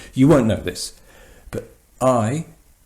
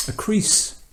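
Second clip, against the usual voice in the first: ordinary delivery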